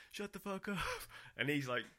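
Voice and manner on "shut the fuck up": high, mock crowd yell; imitated crowd heckle. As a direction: heckle the stage like a fed-up audience. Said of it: "the crowd would be like".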